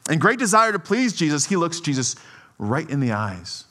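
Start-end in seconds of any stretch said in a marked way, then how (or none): none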